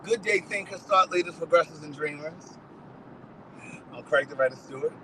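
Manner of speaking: soft announcer greeting to listeners; measured podcast intro voice; host show opener. Acted. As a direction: open the show like a radio host.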